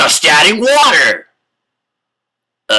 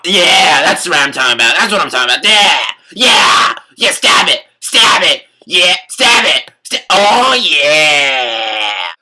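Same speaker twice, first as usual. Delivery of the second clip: excited shouting; 3 semitones lower than usual. Acted. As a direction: cheer it on at full volume.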